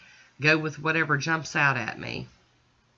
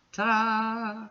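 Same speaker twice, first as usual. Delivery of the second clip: bright, higher ta-da with a flourish; excited reveal; 7 semitones higher than usual. Acted. Showing viewers the finished nails, an excited ta-da. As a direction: present it like a magic trick.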